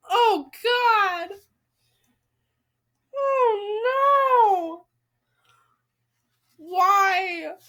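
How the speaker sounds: loud, high, crying-out despair; anguished outcry. Real, not acted. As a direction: cry out at the page in real dismay. Reacting to a shocking story twist.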